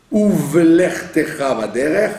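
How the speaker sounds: Uvlertecha Vaderer said slowly, both shevas sounded; careful Hebrew reading. Shown as a demonstration. Teacher reading a verse to class, slow and careful. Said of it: "Donc vous dites".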